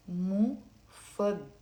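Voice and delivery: مفضلة slowly split into "mu, fad, da, le"; four separate beats on مفضلة; syllable by syllable. Shown as a demonstration. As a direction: leave a gap after each syllable of مفضلة. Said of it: "Four syllables".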